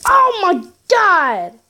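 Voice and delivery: high, loud, drawn-out cry; alarmed yell. Real, not acted. Player reacting to a freaking snake, alarmed yell. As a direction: yell it high and slow in shock.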